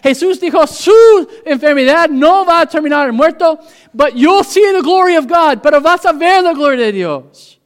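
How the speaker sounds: high, loud preacher's cry; full-force preaching. Acted. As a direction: preach it out to the whole church with fire. Preaching in two languages.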